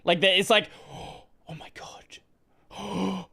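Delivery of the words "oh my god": mock gasp. Mocking shocked Americans.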